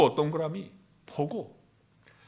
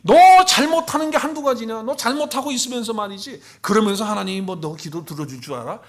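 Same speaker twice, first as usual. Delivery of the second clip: accusing inner voice; 4 semitones higher, 6 dB louder than usual. Acted. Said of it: "계속해서 우리에게 속삭인다는 거예요".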